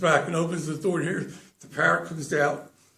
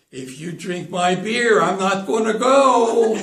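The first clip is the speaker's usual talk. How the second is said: slow, loud threat building higher; loud indignant turtle; 4 semitones higher, 8 dB louder, slower than usual. Acted. Third turtle warns from the door about his beer, loud indignant call. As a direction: call it out slowly, swelling to the last word. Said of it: "sticks his head in the door and he says".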